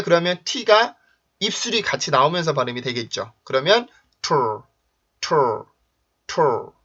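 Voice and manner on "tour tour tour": "tour" with lips pushed out on the t; short demo of tr onset as "tour"; rounded-lip T+R. Shown as a demonstration. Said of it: "이렇게 발음을 해줘요".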